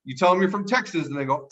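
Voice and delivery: ordinary talk, nothing marked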